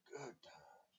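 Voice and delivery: "Good times" fluisterend herhaald, stemloos; whisper. Real, not acted. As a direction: fluister het na, voor jezelf. Repeats phrase, then whispers it.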